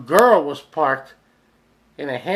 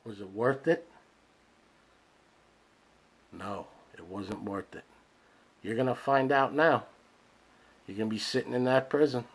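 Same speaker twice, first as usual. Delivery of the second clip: low, very soft, spaced out; hushed reckoning; 6 semitones lower, 10 dB quieter than usual. Acted. Addressing the shooter about his prison time, hushed and low. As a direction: drop right down after the rant. Quiet aftermath after the rant.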